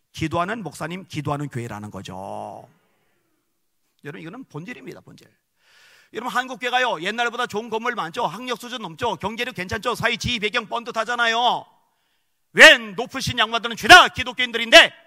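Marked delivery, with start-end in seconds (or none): 6.1-14.9 loud, high, impassioned sermon climax; fiery preaching. Acted. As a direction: preach it out to the whole hall. Preaching at full force.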